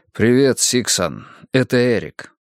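usual speaking voice

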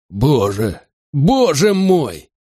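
slow, drawn-out dread, leaping high; frightened exclamation. A narrator's reading. Wayne on hearing of recent events, frightened exclamation. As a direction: let the second cry climb and stretch. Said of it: "Уэйн безумно испугался".

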